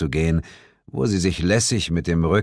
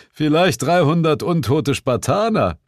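higher-pitched wisecrack; joking; 9 semitones higher, 4 dB louder than usual. A narrator's reading. Bill joking about what came from the sea, a high wisecrack. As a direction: toss it out as a joke. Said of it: "witzelte Bill".

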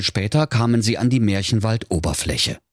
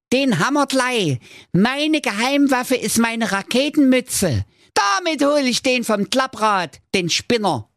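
high squeaky boast, full of cheek; cheeky dwarf. Acted. Dwarf Mirko boasts of his rocket hat, cheeky and squeaky. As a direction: brag like a cocky little agent. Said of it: "rief der Zwerg Mirko keck".

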